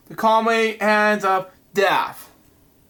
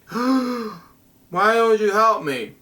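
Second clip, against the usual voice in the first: slow and pleading, with a gasp first; hammed-up pleading gasp; desperate plea; 2 semitones higher, much slower than usual. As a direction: beg the passers-by to help you. Desperate stranger begging for help.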